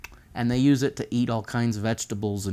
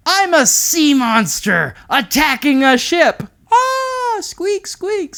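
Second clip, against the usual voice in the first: high, slow, loud playful mouse; squeaky mouse voice; 8 dB louder, slower than usual. Acted. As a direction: squeal it like a tiny mouse sea monster. Mouse voiced in picture story.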